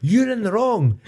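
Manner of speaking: high, loud accusation; retold shouting. Driver blaming the other motorist, indignant shouting. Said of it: "'cause I was shouting at him".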